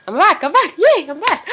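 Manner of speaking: loud, high, delighted Nigerian accent. Real, not acted. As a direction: shout it in your Nigerian accent. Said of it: "back to all, um, Nigerian me".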